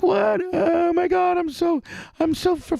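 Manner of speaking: high-pitched falsetto panic; mock anxious Erica. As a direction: squeal in exaggerated, stammering fright. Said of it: "she starts getting anxiety".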